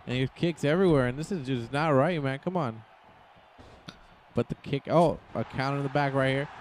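ordinary talk

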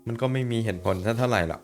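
ordinary talk

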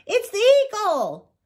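very high, urgent warning cry; high alarm call to a friend. A narrator's reading. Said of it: "he called".

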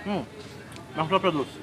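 speaking between bites; talking while chewing. Real, not acted. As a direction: mouth partly full of food. Seen 1: Bit the chop at -3; lips closed and chewing while he speaks.

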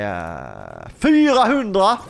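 high, drawn-out exclamation of disbelief; stunned outburst; shocked at price. Real, not acted. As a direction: blurt it out high and slow, ending on a questioning rise.

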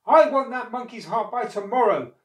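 high, loud ultimatum; insistent demand. A narrator's reading. Crocodile's wife refusing to be swayed, insistent demand. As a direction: demand it, refusing all argument.

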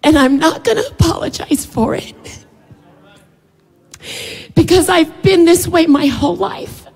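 holding back tears while speaking; real tearful catch in the voice; emotional. Real, not acted. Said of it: "I've been real emotional today".

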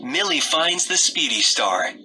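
ordinary delivery

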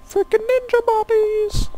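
slow falsetto squeal; very high, drawn-out comic cry; mock outrage. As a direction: stretch it out in a tiny high voice. Jokes about vanished bodies.

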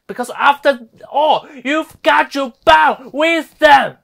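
loud, high, drawn-out outburst; grito indignado. Acted. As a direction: throw it at them in a slow shout. Angry climax of sarcastic tirade.

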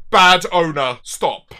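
loud, firm telling-off; scolding. Real, not acted. Scolding a cat owner for filming, loud reprimand. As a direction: burst out loud, then cut it short.

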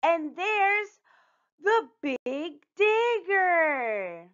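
high, awed, slowing to a long stretched finish; gasped in wonder. A narrator's reading. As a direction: draw it out in amazed discovery. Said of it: "Artie and Gloria gasped".

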